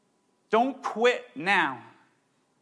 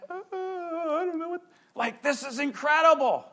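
high, lost-for-words gush of delight; overwhelmed with joy; 8 semitones higher than usual. Retold or imitated. Pastor imitating a believer, gushing in speechless joy. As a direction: babble like joy has stolen your words.

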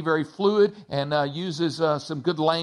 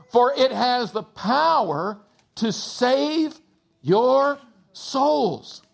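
higher, measured reading of scripture; 5 semitones higher, slower than usual. Acted. Pastor reading James to the church, measured and solemn. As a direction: read the verses with weight.

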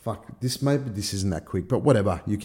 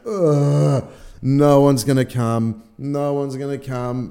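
loud, higher groaning panic; mock freak-out; 5 semitones higher, 8 dB louder, slower than usual. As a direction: wail it in exaggerated despair. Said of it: "Freaking out".

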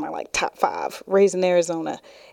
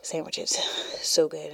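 whispered; 3 semitones lower, 6 dB quieter, slower than usual. Real, not acted. Savoring Klondike bar sandwiches.

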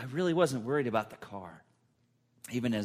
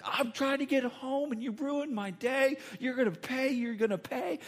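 much higher, mock furious trucker; imitating angry yell; 12 semitones higher than usual. Other driver yelling at the teen who hit him, mock rage. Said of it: "he was able to scream and yell at me".

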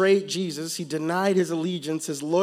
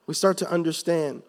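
normal talking voice

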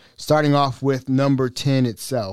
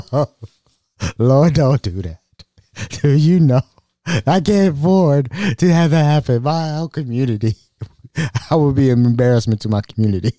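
mock crying; 2 semitones higher, 3 dB louder than usual. Pleading with the scammer to spare his reputation, mock crying. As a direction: wail and beg like a fake weeper. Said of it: "I start crying again".

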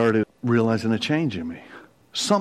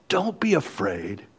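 ordinary delivery

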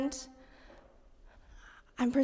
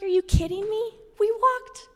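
higher, exasperated disbelief; mock an exasperated mom, pitched up; retold frustration; 8 semitones higher than usual. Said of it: "got furious".

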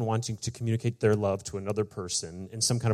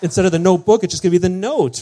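higher, louder, giddy movie pitch; over-excited cheering; 9 semitones higher, 10 dB louder than usual. Retold or imitated. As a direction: gush like an overinvested fan. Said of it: "way over cheering for this".

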